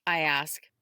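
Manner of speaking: ordinary delivery, nothing marked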